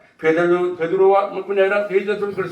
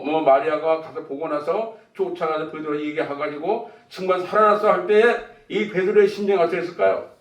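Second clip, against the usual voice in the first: ordinary talk, nothing marked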